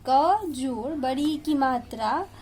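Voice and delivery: ordinary delivery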